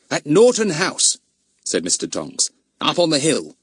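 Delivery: a hint of a plain policeman; constable answering.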